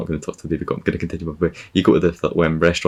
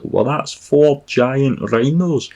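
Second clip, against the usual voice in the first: high, slow exclamation of wonder; mock amazement; 8 semitones higher, 4 dB louder, much slower than usual. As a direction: stretch it out in exaggerated awe. Acted amazement at four rhinos.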